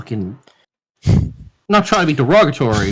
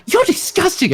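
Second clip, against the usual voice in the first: high-pitched imitation of her outrage; high, scandalised mimicry; mock disgust. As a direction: imitate a disgusted woman scolding. Quoting her disgusted insult.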